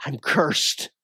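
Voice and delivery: low, slow, hushed cry of ruin; despairing confession. Retold or imitated. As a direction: let it sink, broken and quiet. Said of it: "He said".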